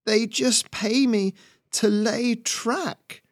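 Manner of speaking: high, slow wheezy beaver voice; wheezing beaver. A narrator's reading. Injured beaver excusing himself to attacker, high wheeze. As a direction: plead in a strained, high wheeze. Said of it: "the beaver wheezed".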